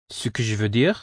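"ce que je veux dire" with a gentle rise on "dire"; slight rise at the end. Shown as a demonstration. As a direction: lift the tone a little on "dire". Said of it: "a slight rising tone toward the last word".

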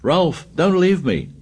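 high, frightened wail; cling to him with the voice; a scared boy begging his friend not to leave, wailing. A narrator's reading. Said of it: "Piggy wailed".

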